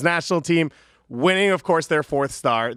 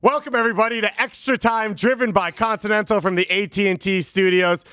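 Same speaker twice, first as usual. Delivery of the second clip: show host; 4 semitones higher than usual. Acted.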